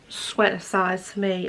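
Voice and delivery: ordinary talk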